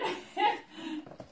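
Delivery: high nervous giggle; nervous laugh. Real, not acted. Vlogger dreading her hip thrusts, nervous laugh. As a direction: let out a nervous little laugh. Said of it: "It's a nervous laugh".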